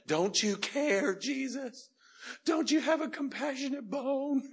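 high, loud mock complaint; drawn-out, exaggerated high-pitched plea; hammed-up whining Martha. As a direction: wail it like an overdramatic, put-upon sister. Mocking Martha's complaint to Jesus.

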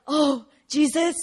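slow, drawn-out pious cry, lightly mocking; mock churchy exclamation. Imagining churchgoers, a mock pious exclamation. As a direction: ham up an over-devout churchgoer. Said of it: "I thought everyone would be really like".

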